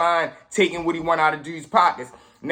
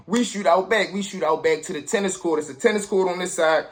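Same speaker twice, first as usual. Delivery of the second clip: ordinary talk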